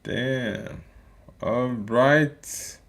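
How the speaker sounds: ordinary talk, nothing marked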